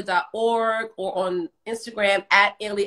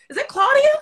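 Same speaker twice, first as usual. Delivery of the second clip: much higher, louder, thrilled to see her friend; excited greeting; 5 dB louder than usual. Real, not acted. Greeting her friend Claudia on the call, excited and loud. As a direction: squeal her name with delight.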